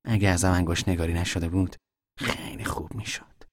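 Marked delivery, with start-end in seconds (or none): 2.2-3.4 whisper. Acted. Son whispering back.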